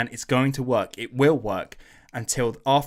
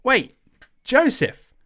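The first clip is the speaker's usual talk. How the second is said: high, loud, startled shout of recognition; much higher, louder amazed greeting; surprised recognition; 12 semitones higher, 7 dB louder, slower than usual. Retold or imitated. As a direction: call out to an old friend in shock. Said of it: "and he's like".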